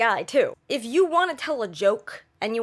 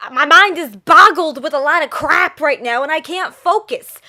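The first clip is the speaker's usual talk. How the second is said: loud, worked-up mock complaint; acted overwhelmed outburst; 3 semitones higher, 10 dB louder than usual. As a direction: blurt it out as if overwhelmed. Acts out an overwhelmed person.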